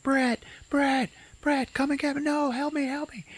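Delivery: hammed-up desperate cry; mock calling for help. [name] calling Brett to rescue her, high mock panic.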